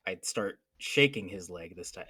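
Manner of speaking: normal talking voice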